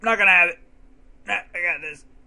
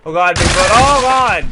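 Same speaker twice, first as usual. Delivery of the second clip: alarmed yell; 9 dB louder than usual. Real, not acted. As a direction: shout in sudden alarm. Monster scare in game.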